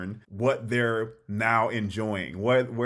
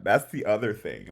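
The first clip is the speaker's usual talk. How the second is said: ordinary talk, nothing marked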